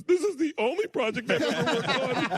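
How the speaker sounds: put-on high, light kid voice for the little daughter; child's comeback. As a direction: pipe it up like a little girl with a deadpan punchline. Quoting child Lilu's comeback.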